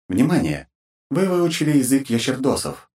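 filtered system notification voice; formal automated alert through a filter; game system announcer. Acted.

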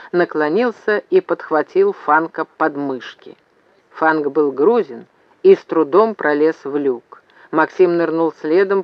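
ordinary delivery, nothing marked

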